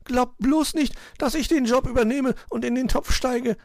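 very high anxious bird voice; high, frightened chirping; trembling little bird. A narrator's reading. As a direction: squeak it as if shivering with fright. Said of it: "sagte er bibbernd".

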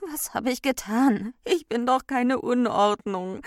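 high, loud wailing plea; tearful. A narrator's reading. Ordnung weeps over her wrecked house, tearful. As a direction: wail it as if starting to cry. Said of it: "Die Ordnung begann zu weinen".

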